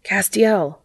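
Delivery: restrained narrated shout; mild shout. A narrator's reading. Jack calling Castiel from the rocks, mild shout. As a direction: call out the name without full volume. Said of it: "Jack shouted".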